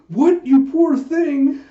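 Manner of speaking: high, loud, drawn-out gasp of pity; shocked pity. Acted. Jaeha reacting to Huck's bodyguard job, shocked pity.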